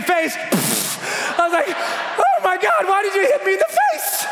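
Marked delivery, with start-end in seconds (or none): 1.7-4.3 hammed-up hurt protest; distressed wail. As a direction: wail it like a betrayed victim. Acts wailing after being hit.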